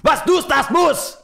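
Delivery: hammed-up German, pitched high; mock German. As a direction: overplay the German accent. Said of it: "I'm getting good at it".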